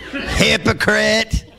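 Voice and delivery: slow "Hypocrite" through a chuckle; rebuke with a laugh. Acted. Pastor relishing Jesus' rebuke, slow and laughing. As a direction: relish the word, half laughing. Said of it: "called it straight out".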